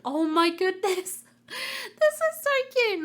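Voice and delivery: high, slow gushing squeal; squealing with delight. Real, not acted. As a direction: coo over something adorable. Gushing over a cute snap.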